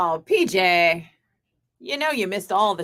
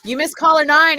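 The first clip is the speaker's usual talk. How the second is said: excited; 7 semitones higher, 8 dB louder than usual. Real, not acted. Excited news of a missed call.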